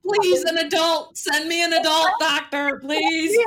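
pleading for an adult doctor, slow; mock plea. Daughter teasing mom about the student doctor, mock pleading. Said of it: "And you're like".

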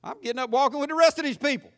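put-on high, naive boy thinking it over; the child's obvious logic; kid's inner voice. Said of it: "And I thought".

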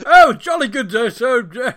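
high, loud mock posh English accent; plummy lost toff voice, hammed up; posh English impression. To mates in a Millwall pub, a hammed-up posh English accent. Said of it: "Please tell me you did that with your impressions".

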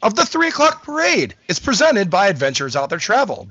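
upbeat announcer sponsor read. Acted. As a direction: read it like a radio ad.

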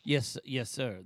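ordinary talk

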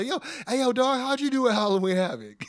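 high-pitched teasing wrestler voice; R-Truth. Retold or imitated. Acting Truth teasing Waller.

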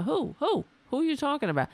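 high incredulous who. Real, not acted. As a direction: demand who he means. Incredulous questioning of the claim.

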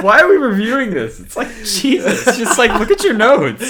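high, loud mock whine at officials; mock complaint. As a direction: complain in an exaggerated high voice, half laughing. Mocking commentators complaining about a review.

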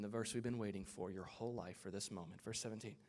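low, very soft build-up; hushed mock reverence. Shown as a demonstration. Preacher introducing the long-awaited verse, hushed and low. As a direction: drop right down as if unveiling a secret.

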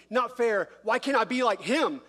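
jealous whine. Retold or imitated. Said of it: "the things I start seeing".

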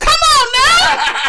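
high, loud, drawn-out cheer; excited shout. Real, not acted. Host thrilled the guest guessed the song, shouting. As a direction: shout it in delight through laughter.

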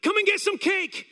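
high, loud call-out; high-pitched holler from the mayor; retold shout. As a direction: shout it over as an invitation. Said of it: "But he just shouted".

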